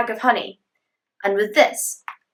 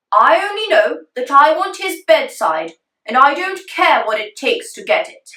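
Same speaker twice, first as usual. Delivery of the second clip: demanding king; 5 semitones higher, 5 dB louder than usual. Acted.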